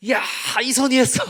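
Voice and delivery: loud, high bragging shout; boastful pastor. Pretend. Preacher mocks an arrogant pastor bragging to his friend, shouting. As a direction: crow that you did it yourself.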